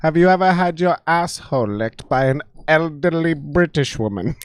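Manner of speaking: put-on creepy elderly English lady; creepy British woman. Elderly lady questioning Vincent, creepy British woman. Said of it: "just a creepy British woman".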